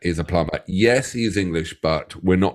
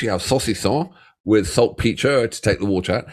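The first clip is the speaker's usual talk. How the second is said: ordinary talk, nothing marked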